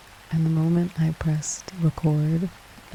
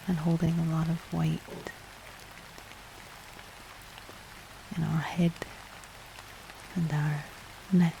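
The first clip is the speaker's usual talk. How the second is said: guided relaxation; 7 dB quieter than usual. Acted. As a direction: gently guide the breathing, hushed and calm.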